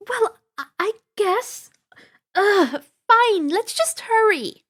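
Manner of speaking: high, loud grudging huff; flustered girl. A narrator's reading. Annabeth grudgingly agreeing, flustered and high. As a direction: give in with an embarrassed groan.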